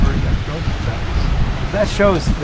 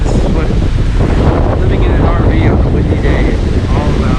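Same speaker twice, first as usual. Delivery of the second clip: loud, raised over the gusts; shouting over wind; 6 dB louder than usual. Real, not acted. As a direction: project over roaring wind.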